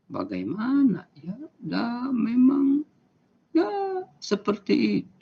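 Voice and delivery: too slow demo. Shown as a demonstration. Teacher demonstrating talking too slowly, dragging it out. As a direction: stretch each word out as if you don't care. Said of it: "Kalau kita berbicara terlalu lambat".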